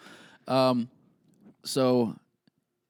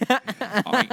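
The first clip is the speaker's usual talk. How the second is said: squeaky hammed-up British hi, much higher and louder; over-the-top British accent; 13 semitones higher, 7 dB louder than usual. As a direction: chirp it like an overdone Brit. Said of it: "do the accent".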